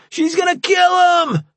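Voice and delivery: high, loud cries for help; shouting for help. A narrator's reading. AJ calling the guards through the door, high shout. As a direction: yell it at the door. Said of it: "A J shouted".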